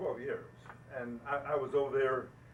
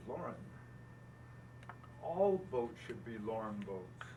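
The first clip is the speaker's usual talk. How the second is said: slow, low-pitched earnest correction; measured, solemn retelling; gravely serious; 5 semitones lower, 7 dB quieter than usual. Retold or imitated. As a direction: quote him slowly and very seriously. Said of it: "looked at me very seriously".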